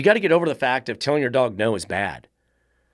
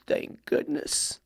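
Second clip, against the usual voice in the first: breathy whispered dog voice, much softer and slowed; dog's relieved thought; 10 dB quieter, slower than usual. Retold or imitated. The dog Fluffy answering its owner in a soft whisper. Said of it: "and Fluffy goes".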